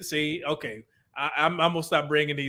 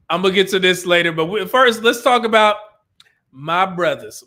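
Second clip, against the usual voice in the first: louder and fast, pushing on; energetic redirect; 7 dB louder than usual. Real, not acted. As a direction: snap into hype-host mode. Louder, faster repeat to redirect.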